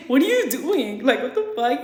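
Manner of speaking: retold bewildered challenge; baffled confrontation. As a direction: ask it in mock disbelief. Retells confronting him at back door.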